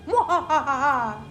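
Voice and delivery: villain's evil laugh; evil cackle. Acted. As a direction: cackle like a cartoon baddie.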